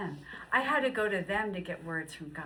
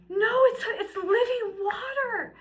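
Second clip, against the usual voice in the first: high, loud, slow, near crying; high tearful plea to husband; 14 semitones higher, 9 dB louder, slower than usual. Retold or imitated. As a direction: plead through tears.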